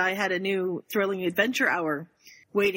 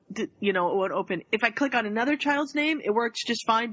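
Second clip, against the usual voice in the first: ordinary delivery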